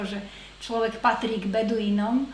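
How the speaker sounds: normal talking voice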